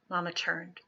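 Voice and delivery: ordinary delivery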